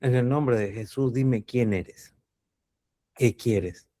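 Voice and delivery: soft, slow, steady order to the demon; calm quiet command. Retold or imitated. Exorcist calmly ordering the demon, quiet and slow. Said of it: "Hasta que un día".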